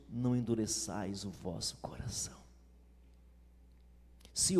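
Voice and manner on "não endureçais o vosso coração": whisper. Acted. Verse read intimately.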